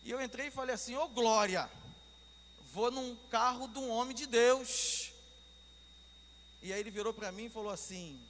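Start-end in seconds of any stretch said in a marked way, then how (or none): 0.9-5.1 loud, raised, drawn-out praise; jubilant exclamation. Retold or imitated. Preacher recalling boarding the believer's taxi, jubilant. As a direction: re-enact rejoicing at a godly find.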